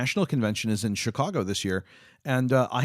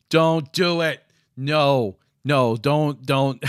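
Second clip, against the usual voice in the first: louder, higher "don't do it" pleas; loud pleading; 3 semitones higher, 6 dB louder, much slower than usual. Real, not acted. Urging Sturlock not to give the teddy pack, loud plea. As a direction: beg him to stop, loud and urgent.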